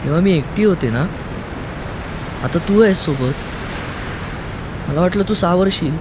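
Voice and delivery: a hint of a scared girl complaining; lightly voiced young woman. A narrator's reading. Kaumudi reproaching Aruni, mildly hurt.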